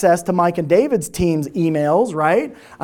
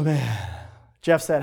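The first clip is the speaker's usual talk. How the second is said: usual speaking voice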